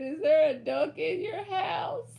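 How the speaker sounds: amused, giggly question; laughing read-out. Real, not acted. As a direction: barely get it out through laughter. Laughs reading listener's comment.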